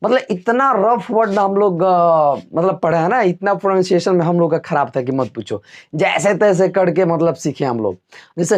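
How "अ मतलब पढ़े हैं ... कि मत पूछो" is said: ordinary delivery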